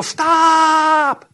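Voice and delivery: high, drawn-out halt cry; mock commanding shout. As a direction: cut through the babble with one long, high cry. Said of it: "the Holy Spirit would go".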